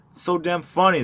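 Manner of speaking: high, loud delight; egging him on; excited. Acted. Drunk friend urging a story.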